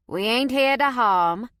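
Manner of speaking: easy Cajun drawl; Cajun accent. A narrator's reading. Cajun stranger assures the survivors in Cajun accent. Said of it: "in a heavy Cajun accent".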